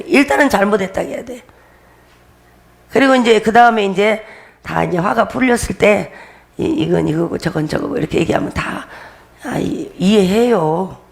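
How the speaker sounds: normal talking voice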